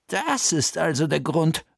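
ordinary delivery, nothing marked

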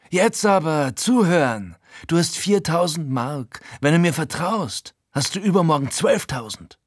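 Frankie, hoch und eindringlich überredend; aufgeregter Gauner. Acted. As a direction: verkauf ihm den Deal mit hoher, drängender Stimme. Frankie preist seinen Plan an. (Frankie überredet den Erzähler zu einem Geschäft, hoch und eindringlich)

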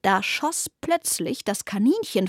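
usual speaking voice

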